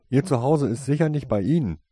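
loud, rude remark; loud retort. A narrator's reading. Tasein as the unfriendly child, loud to the parents. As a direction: say it loud and defiant. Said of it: "ließ sich Tasoin laut vernehmen".

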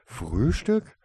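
high, loud mischievous question; a grandfather's impish interjection. A narrator's reading. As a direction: pipe up with roguish delight. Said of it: "ertönte die schalkhafte Stimme".

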